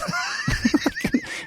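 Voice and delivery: very high giggling laugh; high squeaky laugh. Real, not acted. Laughing at his own story.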